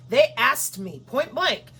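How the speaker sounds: ordinary delivery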